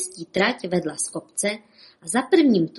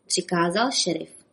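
usual speaking voice

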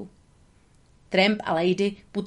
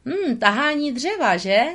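thoughtful musing; 6 semitones higher, 3 dB louder than usual. Acted. Tramp muses to the beaver, thinking it over. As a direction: muse aloud as if an idea is forming. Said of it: "řekl Tramp zamyšleně".

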